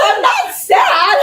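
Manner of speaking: loud, high wail drawn out through a laugh; hammed-up bawling, very slow; mock crying. Nail tech crying at client's gift, comic wail. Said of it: "I just started crying".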